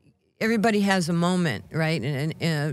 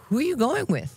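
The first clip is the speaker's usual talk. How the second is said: higher, mock-worried mom asking; a wary mother asking who that is; mom's suspicious question; 7 semitones higher than usual. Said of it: "and my mom's like".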